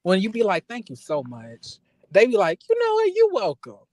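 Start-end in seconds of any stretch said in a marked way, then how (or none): 0.7-1.8 soft, slow sincere thanks; heartfelt thank you. Retold or imitated. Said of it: "tell them a real strong thank you". 2.7-3.8 high, bright-pitched you're welcome; heartfelt worker reply. Retold or imitated. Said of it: "the welcomes that they give me back ... be just so heartfelt".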